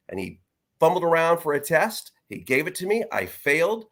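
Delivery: usual speaking voice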